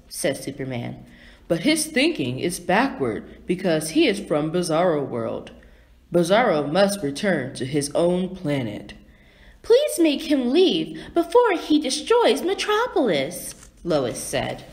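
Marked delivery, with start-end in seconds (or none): 1.5-8.9 lower-pitched hero explaining, lightly voiced; a hint of a steady, low superhero; Superman. A narrator's reading. Superman explains Bizarro to Lois, lightly voiced low hero. 9.6-13.5 high-pitched plea; pleading Lois. A narrator's reading. Lois begs Superman to send Bizarro away, high pleading voice.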